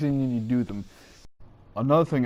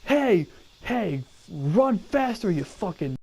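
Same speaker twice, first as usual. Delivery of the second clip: drill sergeant; 6 semitones higher, slower than usual. Retold or imitated. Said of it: "a drill sergeant could sit there and say".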